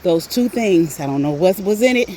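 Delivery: usual speaking voice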